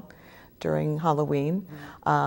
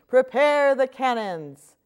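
slow, higher, louder mock pirate yell; 8 semitones higher, 4 dB louder, much slower than usual. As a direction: shout it like kids playing pirates. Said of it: "yell her little pirates".

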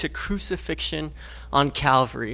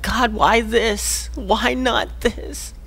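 slow, higher, questioning plea to God; drawn-out asking why, softer each time; slow pleading question; 6 semitones higher, 3 dB quieter, much slower than usual. Acted. Voicing a struggling believer.

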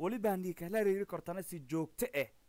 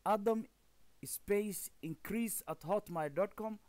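slow and a bit higher, word by word; slow careful dictation; 4 semitones higher, slower than usual. Shown as a demonstration. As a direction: spell it out slowly for students. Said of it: "repeating address slowly for students".

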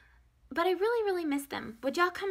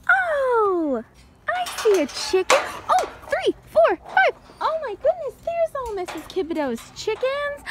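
much higher and louder, thrilled; excited discovery; 10 semitones higher, 6 dB louder than usual. Pretend. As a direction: show the kids a big find. Finding chickens for children.

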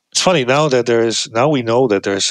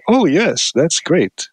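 eager acceptance; 6 semitones higher than usual. Shown as a demonstration. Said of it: "the preferred response is to say".

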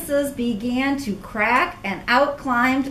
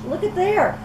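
higher, eager pointing out; excited; 7 semitones higher than usual. Real, not acted. Reader shows the child the hiding Clara, high and eager. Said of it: "reader excited aside".

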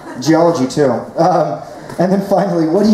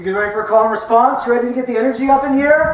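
high-pitched crowd-rousing shout; rallying call-out; 11 semitones higher than usual. Real, not acted. Comedian rousing audience for call and response, rallying shout. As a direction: whip up the room, pitched high.

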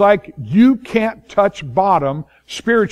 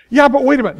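higher, louder objection; mock protest; 8 semitones higher, 4 dB louder, much faster than usual. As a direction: cut in eagerly. Objector interrupting Paul.

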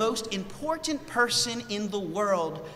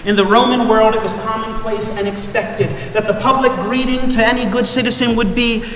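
ordinary talk

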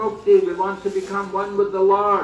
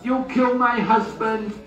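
higher, slow pleading wife; retold wife begging, pitch lifted; imitating wife's plea; 7 semitones higher, slower than usual. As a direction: beg for the husband's life in a raised voice. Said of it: "came and pleaded".

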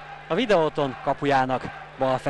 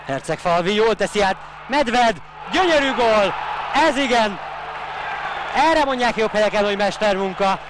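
excited goal call; 5 semitones higher than usual. Real, not acted. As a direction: shout the goal out with joy. Live commentary of an equalising goal.

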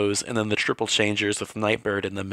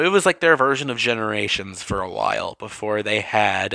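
usual speaking voice

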